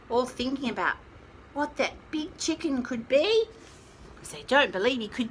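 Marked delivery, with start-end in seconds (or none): none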